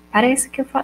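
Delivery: usual speaking voice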